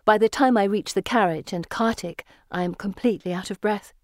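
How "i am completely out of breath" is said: plain reading voice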